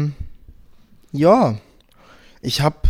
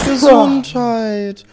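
loud gesundheit; 8 semitones higher, 7 dB louder, much slower than usual. Acted. Blessing again, louder.

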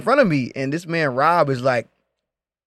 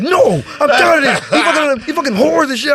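high, raised-voice imitation of an angry friend; loud aggressive rant; 10 semitones higher, 4 dB louder than usual. Heated Rob fed up with the dancers, loud aggressive rant. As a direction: blow up loudly, pitched way up. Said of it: "he getting loud and more and more aggressive".

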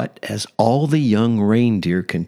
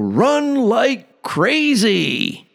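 high, slow hollering prospector; 13 semitones higher, much slower than usual. Acted. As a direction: boom it out like a wild prospector. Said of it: "said Yukon Cornelius".

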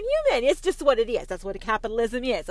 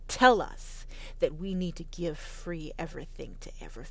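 mock whisper; 6 semitones lower, 6 dB quieter than usual. Mock patriot hissing.

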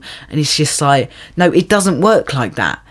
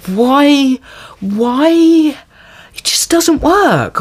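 exasperated; 10 semitones higher, much slower than usual. Real, not acted. Exasperated rant about film.

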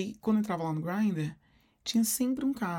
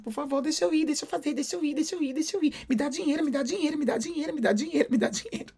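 lightly voiced, higher pleading child; whiny begging kid; 7 semitones higher, faster than usual. Kid pestering his mother for money, whiny begging. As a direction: nag like a kid pestering mom. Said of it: "De ficar insistindo".

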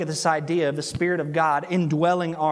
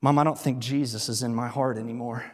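voice sinking; 6 semitones lower, 3 dB quieter than usual. Retold or imitated. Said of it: "with her voice kind of sinking".

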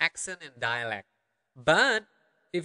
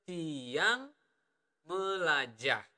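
Tiang Melajah stretched out, with a pause between the words; slower version; much slower than usual. Shown as a demonstration. Teacher giving learners the slower version of "I learn", slow and segmented. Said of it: "or the slower version".

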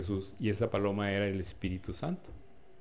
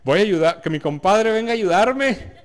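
louder and higher, laughing as he begs for help; hammed-up pleading pregnant man, much louder and higher; mock pregnant man; 8 semitones higher, 10 dB louder than usual. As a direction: ham up a pregnant husband calling his buddy, laughing. Imagining men getting pregnant.

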